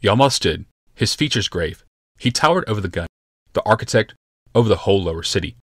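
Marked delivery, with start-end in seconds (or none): none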